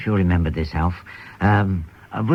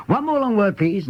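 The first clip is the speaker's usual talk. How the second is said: much higher, slow showman's announcing; imitating a music-hall mental marvel, higher and slower; showman patter; 11 semitones higher than usual. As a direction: play the stage mind-reader working the crowd. Mind-reader patter.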